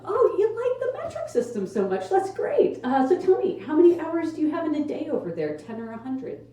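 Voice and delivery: high, mocking sarcastic questioning. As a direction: needle them with fake interest. Mocking smug Europeans.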